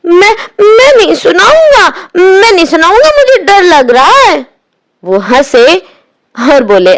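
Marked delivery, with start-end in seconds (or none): 0.1-4.5 high, frightened pleading; a boy begging in fear; scared. A narrator's reading. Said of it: "भोलू डरा". (डरी हुई आवाज़ में)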